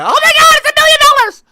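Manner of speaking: gushing art buyers. Retold or imitated. Streamer mocking people who overpay for Banksy, shrieking mock awe. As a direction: parody gullible fans gasping at a price. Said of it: "and people go".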